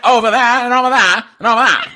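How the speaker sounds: put-on loud, high old-film mid-Atlantic accent. Showing a learner sixties film speech, exaggerated mid-Atlantic accent. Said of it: "that mid-Atlantic accent".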